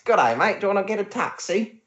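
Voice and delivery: playful Aussie taxi offer; Australian accent. Retold or imitated. Mock Aussie offering travellers a taxi, lightly put-on Australian accent. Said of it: "that's my Australian accent".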